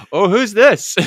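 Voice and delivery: high, loud surprised question; mock surprise. Audience reacting as a woman enters the stage, surprised. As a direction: gasp it like a startled audience member. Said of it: "And you're like".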